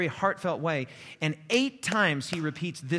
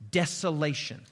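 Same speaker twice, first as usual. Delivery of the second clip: ordinary talk, nothing marked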